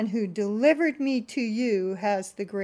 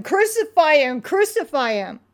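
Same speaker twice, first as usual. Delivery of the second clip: loud, higher, fading shout; crying out; 6 semitones higher, 7 dB louder than usual. Acted. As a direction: demand it, then ease off. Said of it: "they cried out".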